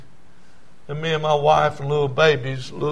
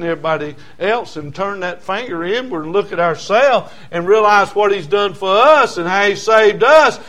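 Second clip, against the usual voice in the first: louder, rising exhortation; forceful preaching; 6 semitones higher, 9 dB louder than usual. Acted. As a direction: build to an emphatic pulpit point.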